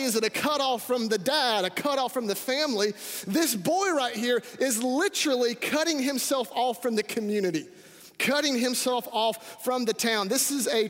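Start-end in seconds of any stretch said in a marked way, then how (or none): none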